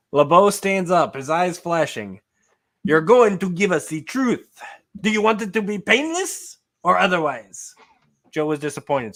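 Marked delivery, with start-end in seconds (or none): 2.8-7.7 put-on French accent. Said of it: "so I gave him my accent".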